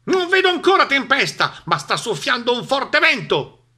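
high, loud quacking duck voice; shouting duck. A narrator's reading. Duck warns the animals of the wind, high shouting voice. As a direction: cry it out as a squawky cartoon duck. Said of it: "Papera gridò ancora".

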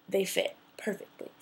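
hushed, awed whisper. Real, not acted. Trying on the dream shoes, awed whisper. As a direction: whisper it like a secret miracle.

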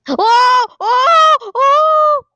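high, loud alarmed cries of oh; startled yells as the power fails; scared shouts. Real, not acted. Player yelling in alarm at the blackout.

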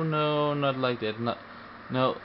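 usual speaking voice

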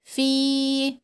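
في held out on the vowel; long ii in في. Shown as a demonstration. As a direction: sustain the ii of في. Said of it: "يبقى ده الصوت بتاعه".

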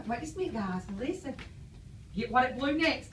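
ordinary delivery

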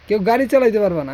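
loud, fast shout of a rule. Real, not acted. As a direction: yell the warning to everyone.

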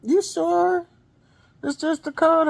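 high-pitched sister voice, doubtful; voicing sister. Sister on the phone doubts it's a cold, high voice. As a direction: lift way up to play the sister. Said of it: "she was like".

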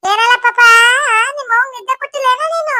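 high-pitched mock scolding to a baby; playful baby talk. Talking to a sleepless newborn, playful scolding. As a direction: coo a teasing telling-off.

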